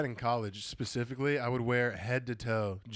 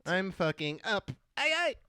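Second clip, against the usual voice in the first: mock drunk party voice; 6 semitones higher, slower than usual.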